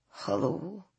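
faint gravelly hello, very soft. A narrator's reading. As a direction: breathe it out weakly. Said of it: "in a very soft, gravelly voice".

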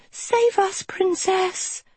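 very high-pitched creature plea; crying animals. A narrator's reading. Animals beg the princess to save them, high cry. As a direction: squeal for help like small animals. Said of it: "they cried".

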